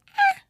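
tiny high-pitched cat noise; cat meow. Retold or imitated. Owner imitating the cat looking at a bug, high meow. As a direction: squeak out a little imitated meow. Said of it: "But he meows at them".

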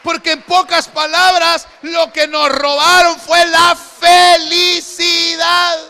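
shouted, slow, half-sung high proclamation; full-force preaching. Real, not acted. Preacher shouting to the congregation, slow and sung. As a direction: preach it out loud and stretched.

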